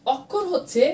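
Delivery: normal talking voice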